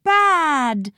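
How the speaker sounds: put-on high-pitched little baby. A narrator's reading. Baby pointing at the villain, high baby voice. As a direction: squeak it out like a baby. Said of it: "said Baby Max".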